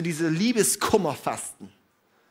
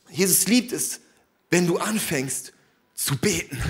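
ordinary delivery

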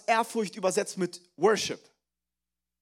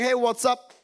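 mock casual greeting; 2 semitones higher, 5 dB louder than usual. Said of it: "dann werde ich nicht sagen".

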